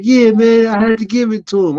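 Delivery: mild mock tough-guy voice; imitating the security guy. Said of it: "He's like".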